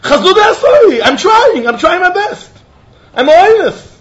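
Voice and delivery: high, loud, half-laughing plea; mock desperate yell. Stranded man yelling to the rabbi, mock desperate cry. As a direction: yell that you're trying your best. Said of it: "This is what he was yelling".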